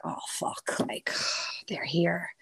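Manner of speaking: breathy whispered curse with a sigh. Retold or imitated. Spotting Joe in the hotel, a whispered curse. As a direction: whisper it like you don't want to be caught.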